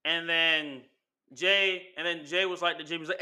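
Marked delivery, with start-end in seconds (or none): none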